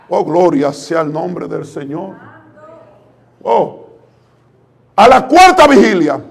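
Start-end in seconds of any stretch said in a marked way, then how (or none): none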